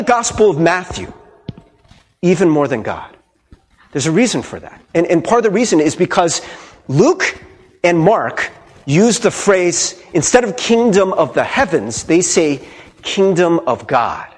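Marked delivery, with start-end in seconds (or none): none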